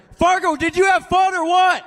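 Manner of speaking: high, loud shout to the stands; rally the arena; calling out to crowd. Real, not acted. Winning driver on the mic hyping the Fargo crowd, shouting. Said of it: "One more time. I think you get a little louder".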